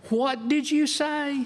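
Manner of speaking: high, slow, sugary "What did you say?"; Southern lady warning. Retold or imitated. Speaker imitates a Southern woman daring someone to repeat it. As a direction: drawl it out sweetly, with danger underneath. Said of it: "if a Southern woman ever says".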